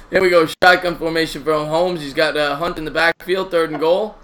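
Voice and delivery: loud, energetic sports commentary; play-by-play call. Acted.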